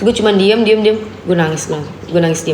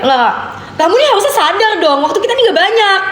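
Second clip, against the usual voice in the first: much higher, harsher rebuke; sharp scolding; 10 semitones higher than usual. Retold or imitated. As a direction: snap it at her. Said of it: "gue nggak pernah dibentak".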